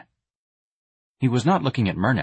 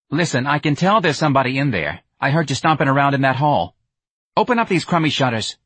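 put-on higher, louder demanding young woman banging on shutters; impatient Myrna; 5 semitones higher, 4 dB louder than usual. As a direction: call through the shutters, pushy and impatient. Myrna calls through the shutters.

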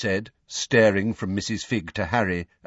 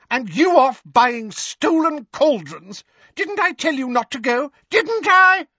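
furious scolding; 6 dB louder than usual. Acted. Figg berating Mundungus.

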